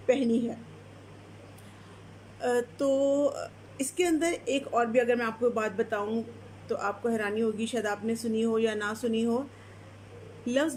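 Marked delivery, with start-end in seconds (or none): none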